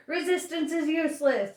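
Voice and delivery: high-pitched guard's bellow; bellowed catchphrase. A narrator's reading. Guard cutting over his prisoner, bellowing. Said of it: "filled his lungs and bellowed".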